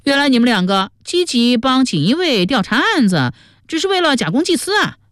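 high-pitched, disapproving character voice. A narrator's reading. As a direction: pitch it high, as if unconvinced by their motives. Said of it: "于宝宝不以为然地说".

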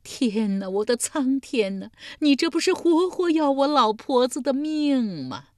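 loud, high cry to heaven that keeps rising; wailing lament. Acted. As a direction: sob it out in despair. Old woman wailing again. (哭天喊地)

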